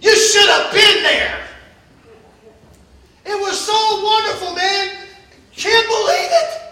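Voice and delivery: loud, laughing preacher opening; preaching at full force. Acted. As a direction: shout it out with a laugh to the church. Preacher shouting, laughing about church.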